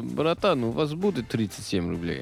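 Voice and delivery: lightly voiced Moscow beggar asking for rubles; street beggar asking. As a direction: mimic a pushy street hustler, lightly. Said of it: "It's always like".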